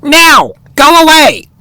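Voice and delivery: high-pitched bossy shout. Acted. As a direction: shoo him off in a high shout. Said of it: "shouted Oxpecker".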